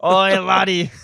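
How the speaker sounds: loud hammed-up Scottish "Oi, laddie."; mock Scottish. Echoing a friend's joke in a mock Scottish accent. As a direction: shout it in a cartoon Scottish accent. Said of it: "trying to impersonate the slang".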